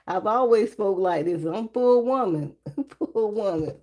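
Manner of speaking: ordinary delivery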